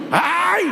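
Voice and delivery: high yelp of pain. Retold or imitated. Man struck on the head.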